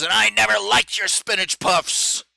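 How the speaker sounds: high, loud mock Yzma voice; a parting shot in a put-on diva voice; villain retort. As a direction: deliver it like a haughty cartoon villain. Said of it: "and she goes".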